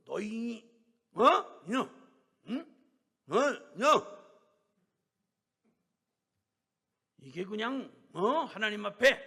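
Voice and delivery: loud, high outraged yell; furious scolding. Retold or imitated. Elder losing temper at the hesitant sister, yelling. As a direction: blow up at her. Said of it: "막 야단을 치셨대요".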